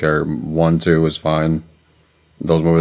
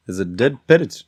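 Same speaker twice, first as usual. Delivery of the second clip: high, louder mock British sketch voice; comedy sketch voice; 10 semitones higher, 4 dB louder than usual. Quoting a famous sketch to friends, high mock British. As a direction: deliver it like a sketch punchline.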